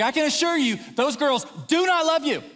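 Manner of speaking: high, emphatic mock-angry assertion; domineering. Overprotective dad facing down a little boy, domineering. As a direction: set the boy straight forcefully. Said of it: "exert my physical dominance over this boy".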